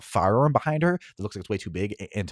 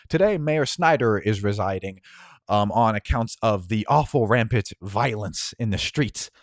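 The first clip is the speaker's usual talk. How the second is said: news report; 7 dB louder than usual. Acted. News broadcast voiced by GM.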